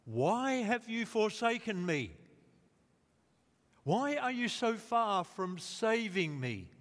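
high, loud anguished plea, slowing at the end; crying out. Acted. David crying out to God, high loud anguish. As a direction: wail the question at God. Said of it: "David cries out".

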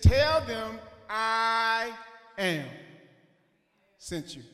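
very slow, drawn-out divine words; slow, solemn God. Retold or imitated. God slowly revealing his name to Moses. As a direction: stretch each word out like a proclamation. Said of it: "He said".

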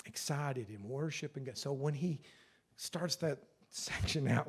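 normal talking voice

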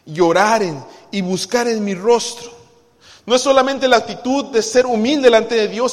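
loud, ringing preaching voice; fervent preaching. Acted. Pastor exhorting the church to humble itself, loud and fervent. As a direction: drive the point home to the congregation.

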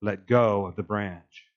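low, slow, commanding voice from the sky; voice of God. Acted. God's voice from the sky, low and slow. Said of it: "the voice says".

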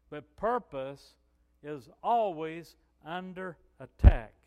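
slow, loud, hammering the point; big pulpit declaration, slow and loud; preaching at full force. Real, not acted. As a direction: proclaim it slowly to the whole room. Preacher driving home a point.

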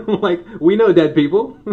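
high, loud scoffing; mocking crowd. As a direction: sneer it like a know-it-all crowd.

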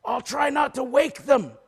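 high, loud, strained mock whisper; stage whisper back. Driver answering the sick worker, exaggerated high stage whisper. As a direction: whisper back at full projection, pitched high. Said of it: "the driver whispers back".

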